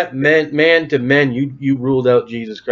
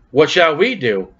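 ordinary talk, nothing marked

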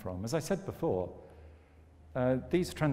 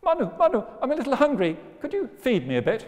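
squeaky fish voice; 12 semitones higher, 6 dB louder, faster than usual. Acted. Fish asks for food.

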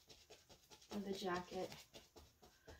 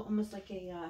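ordinary talk

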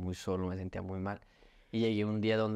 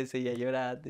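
normal talking voice

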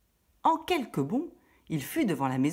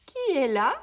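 higher, louder fake granny voice; the wolf pretends to be the old woman; wolf imitating grandmother; 9 semitones higher, 6 dB louder than usual. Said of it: "en imitant la voix de la grand-mère". (le loup contrefait la voix de la grand-mère)

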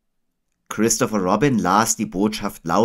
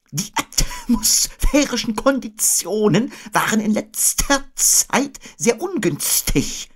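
high, slow, ponderous owl; Eule; 14 semitones higher, slower than usual. A narrator's reading. Owl reporting on the weather, high and drawn out. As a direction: draw out each long word high up. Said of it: "sagte Eule".

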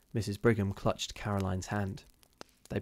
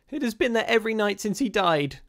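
high, loud anguished cry; distressed cry; 12 semitones higher, 5 dB louder than usual. A narrator's reading. Rebecca to her sisters about the nightly shadow, crying out. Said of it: "cried Rebecca".

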